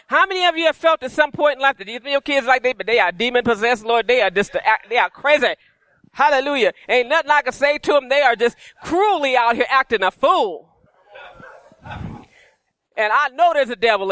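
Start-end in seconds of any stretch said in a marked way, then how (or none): none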